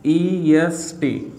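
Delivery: EST drawn out, letter by letter; very slow spelling of EST for learners; spelled out slowly. Shown as a demonstration. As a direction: stretch each letter of EST, pausing between them. Spelling out the new ending.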